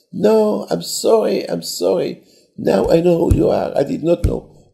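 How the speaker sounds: high, plaintive apologising; acted tearful apology. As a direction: plead sorry as if close to tears. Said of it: "he starts crying".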